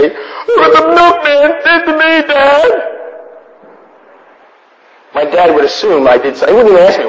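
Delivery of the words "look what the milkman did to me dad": put-on much higher child voice with a laugh; whiny child. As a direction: whine it like a little boy tattling. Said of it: "and say".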